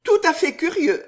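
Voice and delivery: Riquette. Acted. Riquette playing along with the chance meeting, high and emphatic. Woman character feigns surprise politely.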